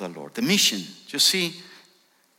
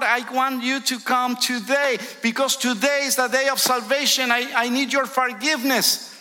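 much higher and louder, preached at full force; impassioned sinner's prayer; 13 semitones higher, 6 dB louder than usual. Acted. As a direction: cry it out like a desperate sinner begging.

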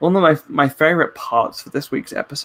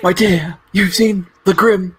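fortune teller voice; 5 semitones higher, 4 dB louder than usual. Pretend. Mock fortune teller reading cups.

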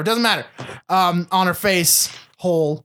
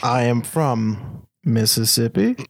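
slow, much lower mock Southern accent; 8 semitones lower, slower than usual. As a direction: drawl it out low and slow like a fake Southern boy. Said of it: "if you just talk slower, that means that you sound more Southern".

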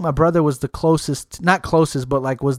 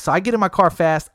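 usual speaking voice

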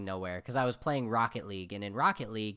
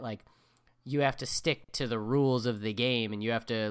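usual speaking voice

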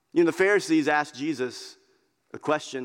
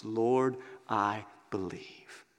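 hushed confession; 6 semitones lower, 7 dB quieter, much slower than usual. Retold or imitated. Repeating the man's confession.

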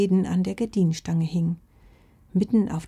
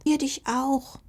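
agreeing squeak; 7 semitones higher, slower than usual. Acted. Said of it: "quiekten ein zustimmendes".

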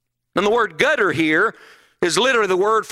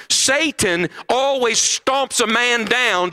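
slow emphatic; 3 semitones higher, much slower than usual. Acted. Sermon contrast, slowed.

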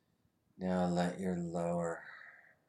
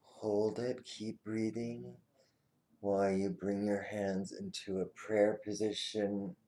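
firm, urgent coaching cues; energetic exercise coaching; 4 semitones higher, 4 dB louder than usual. Acted. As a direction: push them to tense and hold. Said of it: "tense body exercise cues".

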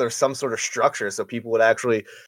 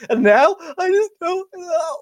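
mock crying; 4 dB louder than usual. Mock hurt after friends' teasing.

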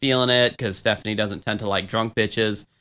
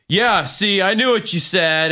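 higher mock drunk smugness; Kimmy; 7 semitones higher than usual. Drunk woman insisting she understood German, mocking imitation. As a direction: imitate a drunk who thinks she won. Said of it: "she goes".

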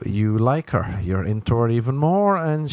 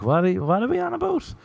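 high, fast bewildered squeak; confused outburst; 12 semitones higher, faster than usual. Retold or imitated. Comic answers the arguer, high and bewildered. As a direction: jump way up in disbelief. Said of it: "And you're like".

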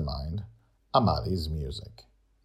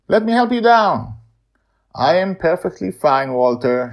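higher, louder character dialogue; a hint of stubborn old woman; lightly voiced characters; 12 semitones higher, 11 dB louder than usual. Amali and Walt exchange.

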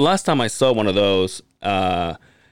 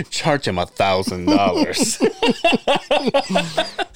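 sly dentist voice; 3 semitones higher, much slower than usual. Retold or imitated.

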